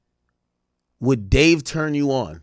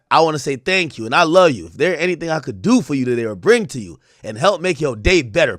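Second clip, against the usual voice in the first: ordinary talk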